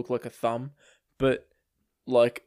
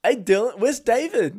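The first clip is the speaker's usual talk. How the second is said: imitated cheery, higher-pitched hello; eager, raised-pitch mock greeting; happy greeting; 8 semitones higher, 4 dB louder than usual. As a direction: chirp it like a delighted newcomer. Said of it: "he was so happy to see me".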